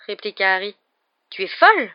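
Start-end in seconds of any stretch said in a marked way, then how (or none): 1.3-1.9 high, loud disbelief; stunned protest, pitch leaping up; aghast. A narrator's reading. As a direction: blurt it out appalled. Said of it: "en lançant à Hermione un regard effaré".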